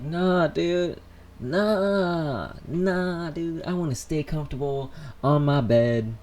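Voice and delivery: high, drawn-out reluctant whine; mock-pleading, stretched-out complaint; whiny refusal. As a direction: protest like someone who won't leave bed. Reenacts refusing to get out of bed.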